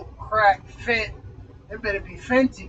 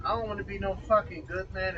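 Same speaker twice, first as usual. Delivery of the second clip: ordinary talk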